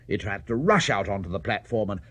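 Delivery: plain reading voice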